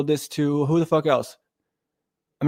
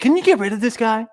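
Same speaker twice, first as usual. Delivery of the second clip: higher, put-on whiny voice quoting Paul; mocking Paul; 7 semitones higher than usual. Streamer mocking Paul's complaint, high put-on voice. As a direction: mimic Paul begging to get rid of him. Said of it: "Paul was like".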